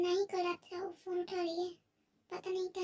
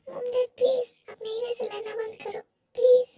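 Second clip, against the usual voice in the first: high, pleading voice close to tears; tearful plea; 4 semitones higher, 7 dB louder than usual. Acted. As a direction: beg them through tears to stop fighting. Daughter begs crying parents stop.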